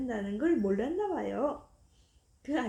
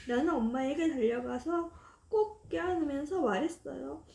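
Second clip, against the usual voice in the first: ordinary delivery, nothing marked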